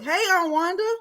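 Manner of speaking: usual speaking voice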